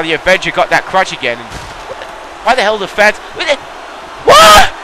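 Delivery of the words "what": a very high, shouted disbelieving "What?"; startled what. Real, not acted. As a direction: react in shock to the glitch. Sees the fan glitch.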